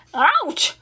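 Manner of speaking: sudden pained yelp; scream of pain. A narrator's reading. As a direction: pig yelping as the rat bites. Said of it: "he screamed".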